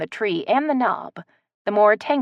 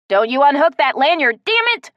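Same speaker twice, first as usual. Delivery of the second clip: angry high yell; 8 semitones higher, 7 dB louder than usual. A narrator's reading. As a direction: yell it in a temper. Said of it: "Kellen yelled".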